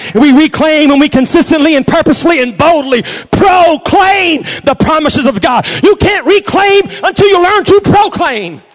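loud, high-pitched shouted declaration, building; full-force preaching. Real, not acted. Preacher declaring the county taken for God, fiery shouted preaching. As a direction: proclaim it like a battle command, at the top of the voice.

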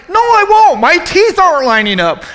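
high, loud panicked protest; panicked shout. Retold or imitated. Injured man yells at his instructor in panic.

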